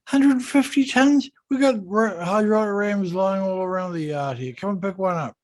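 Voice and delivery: put-on an engineer from Aberdeen shrugs off a hundred-fifty-ton keel, in a broad Scottish accent. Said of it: "a very broad Scottish accent".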